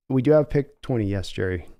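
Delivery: lowkey John Schneider on the phone; low, weary team executive; calm Seattle GM. Acted. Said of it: "give John Schneider".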